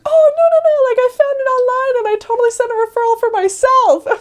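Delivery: high, loud, delighted insistence; proud grandma explaining her trick; chirpy old lady. Retold or imitated. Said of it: "And she's like".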